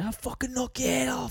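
high, whispered Aussie threat; mock whispered threat. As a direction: hiss it like the angry driver. Retelling the angry driver.

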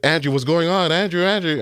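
high, urgent worried mother calling; worried mom. Retold or imitated. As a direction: call his name up high, alarmed. Mom hears son crying.

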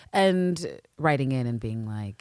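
ordinary delivery

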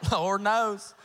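loud, thick Southern drawl; big backwoods Southern accent; thickest Southern drawl. Shown as a demonstration. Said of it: "with our thickest Southern draw accent".

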